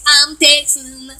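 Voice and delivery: hammed-up slow Texan drawl; slow, silly caricature of Texan talk. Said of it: "everybody in Texas talk like this".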